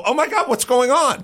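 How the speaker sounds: high-pitched imitation of clueless dismay; mock shocked bewilderment. Mocking a company baffled by falling sales, mock shock. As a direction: play dumbfounded, pitched well up.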